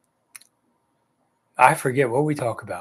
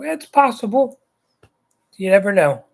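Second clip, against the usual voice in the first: sceptical; 8 semitones higher than usual. Real, not acted. Doubtful about Steve's title hopes.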